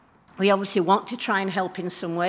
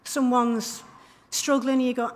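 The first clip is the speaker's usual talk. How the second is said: ordinary talk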